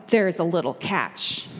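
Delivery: normal talking voice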